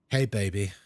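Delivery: lightly mimicked come-on, dropped low; flirty developer. Developers courting a streamer for their new game, lightly flirty. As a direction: greet them in a low, lightly smooth voice. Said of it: "and they said".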